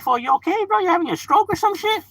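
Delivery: high-pitched disbelieving question; imitated homie's baffled reaction; mock incredulous homie. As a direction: question him like he's lost it. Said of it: "they'd be like".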